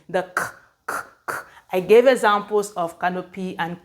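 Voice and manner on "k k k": bare "K" puffs, voiceless and spaced apart; quiet, repeated "K" with no vowel; hard C sound. Shown as a demonstration. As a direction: sound out "K" as a soft click, not the letter name. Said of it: "pronounced or be written as the hard C".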